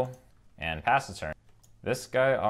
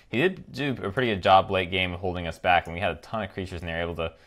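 ordinary talk, nothing marked

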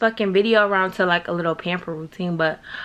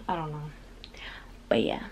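whispered; 5 semitones lower, 13 dB quieter, faster than usual. Real, not acted. Trailing off at end.

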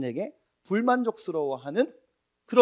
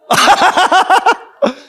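laugh; 13 semitones higher, 10 dB louder than usual. Real, not acted. Laughter after joke.

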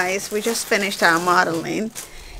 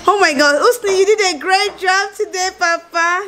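gushing praise; 10 semitones higher, 4 dB louder than usual. Real, not acted. Praising baby after modeling.

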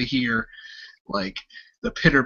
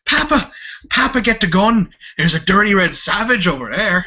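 put-on high, loud panicked child voice; frantic little boy; 8 semitones higher, 9 dB louder than usual. Young son running up to warn his papa, high panicked child voice. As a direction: shriek it like a scared kid running to his father. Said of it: "He goes".

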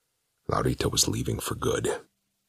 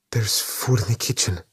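frightened mother; 9 semitones higher than usual. A narrator's reading. Maria answers demon.

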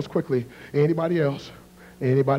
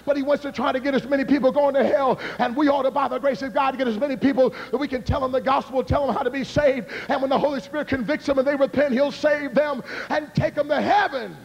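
raised, ringing preacher voice; preaching; 10 semitones higher than usual. Acted. Revival preacher urging the congregation, at full force. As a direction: drive the gospel home to the crowd.

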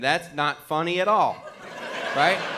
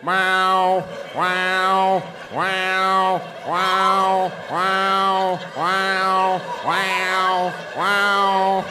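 cat yowling; 2 semitones higher, much slower than usual. Retold or imitated.

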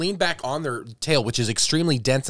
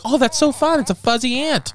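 put-on much higher, delighted kid; retold childhood delight; 13 semitones higher than usual. Retelling a childhood ant find, high excited gushing. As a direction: gush like a thrilled kid. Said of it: "I was like".

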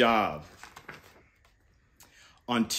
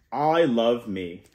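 gentle, lower storybook reading voice; reading to children; 6 semitones lower, 4 dB quieter than usual. Acted. Uncle reading a book to kids, softly. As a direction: read it like a bedtime picture book.

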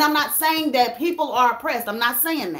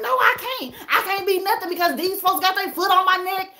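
indignant protest; 6 semitones higher than usual. Retold or imitated. Retold bitter reply, pitched up.

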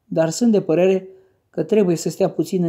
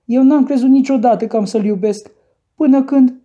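put-on raised, pleading voice of a girl; lightly acted tearful self-defence; tearful protest; 6 semitones higher, 6 dB louder than usual. A narrator's reading. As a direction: plead higher and louder, as if crying. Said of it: "zise Cathy plângând cu hohote".

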